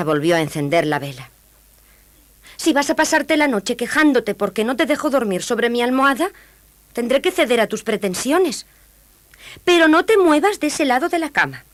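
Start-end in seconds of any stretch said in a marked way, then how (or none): 2.6-11.6 high, grudging princess; grumpy princess. A narrator's reading. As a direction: give in with a high warning. Princess gives in, warning. (princesa de mala gana)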